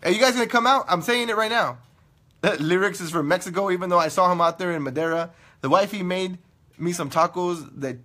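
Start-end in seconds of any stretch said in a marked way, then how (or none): none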